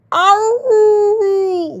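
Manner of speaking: high wailing cry; wailing pups. A narrator's reading. Five wolf pups answering their mother, wailing high. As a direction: wail like little wolf pups. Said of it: "wailed the five".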